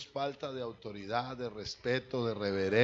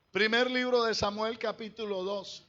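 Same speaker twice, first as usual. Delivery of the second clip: higher, louder pulpit reading voice; scripture announcement; 8 semitones higher, 4 dB louder, slower than usual. Acted. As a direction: proclaim the verse to the whole church. (anuncio solemne de las Escrituras)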